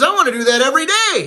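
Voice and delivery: high, quick, eager exclamation; thrilled enthusiasm. Acted. Little Johnny raves to the captain, thrilled. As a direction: gush with acted excitement. Boy raves after the barrel.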